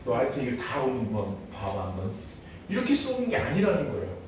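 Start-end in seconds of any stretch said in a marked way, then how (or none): 0.1-2.1 low, soft menacing warning; low threat. Retold or imitated. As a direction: promise punishment later. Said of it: "이렇게 레이저를 막". (아이에게 낮게 으르는 엄마의 경고)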